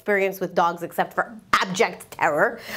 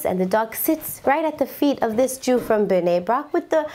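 ordinary delivery

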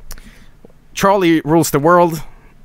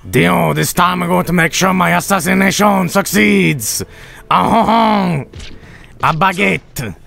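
higher, mock-French swordsman voice; Polnareff; 4 semitones higher than usual. Streamer voicing Polnareff's assassination boast, mock-French and higher. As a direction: ham up a cartoon Frenchman plotting his triumph.

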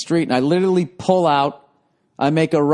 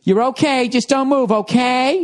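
higher, insistent caring lady, imitated; fussy lady; 8 semitones higher than usual. Imitating the lady who kept telling the injured man not to move, insistent. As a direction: mimic her nagging reassurance. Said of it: "she just kept going".

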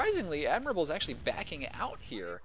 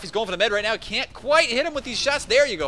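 higher, louder as the kill lands; rising excitement; 9 semitones higher, 9 dB louder than usual. Real, not acted. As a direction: build up to the payoff. Chasing the medic live.

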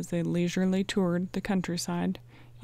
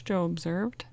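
usual speaking voice

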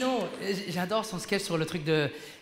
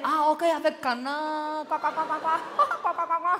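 mock Chinese waiter accent; 6 semitones higher, slower than usual. Comic imitating a Chinese waiter greeting him, mock Chinese accent. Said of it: "le serveur".

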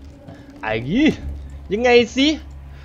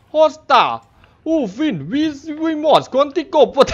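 mock Spanish; 8 semitones higher, slower than usual. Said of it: "ภาษาสเปนฮะ".

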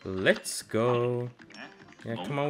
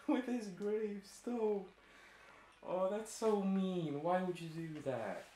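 small, hushed, high voice; slow, soft, wounded whimper; tearful whine; 5 semitones higher, 10 dB quieter than usual. Real, not acted. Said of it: "I'm gonna fucking cry".

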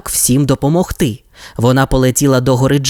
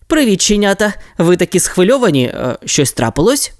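put-on lightly raised, kind boy leader; friendly boy Ryder; 7 semitones higher than usual. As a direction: greet the pups, then ask with concern. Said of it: "спитав Райдер".

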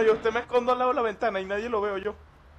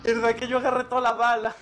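laughing; 3 semitones higher than usual. Real, not acted. Teasing about grabbing ammo.

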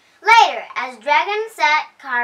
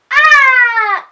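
much higher, louder frightened cry of ah; storybook scream of terror; terrified scream; 12 semitones higher, 12 dB louder than usual. Acted. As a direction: yelp in panic and flee. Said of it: "cried the whale, the fox".

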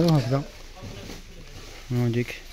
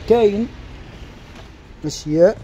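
excited; 5 semitones higher than usual. Real, not acted. Excited sales call to mothers.

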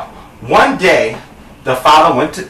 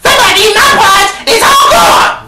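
very high squealing cub; Baby Bear; 3 dB louder than usual. Acted. Little bear finding porridge gone, high-pitched wail. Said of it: "So then Baby Bear says".

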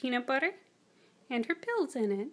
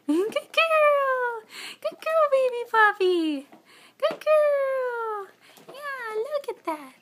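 squeaky praising a dog; 12 semitones higher, 10 dB louder, slower than usual. Real, not acted. Praising dog after treat.

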